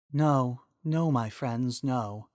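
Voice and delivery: a wise spirit speaking kindly; the Triforce of Wisdom thanking Miranda, gentle and soft; soft female voice. Acted.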